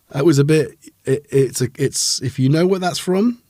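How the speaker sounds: normal talking voice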